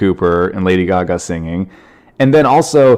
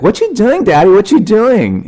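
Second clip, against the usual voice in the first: put-on high-pitched little-kid voice, much higher; imitated young daughter; 13 semitones higher, 4 dB louder than usual. Daughter questioning daddy about his video, high imitated child voice. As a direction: pipe up like a curious small child. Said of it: "She's like".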